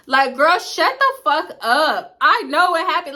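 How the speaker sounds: loud, high-pitched exasperated retort; exasperated outburst. Retold or imitated. Telling a friend to stop recapping, exasperated. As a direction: cut her off, loud and high.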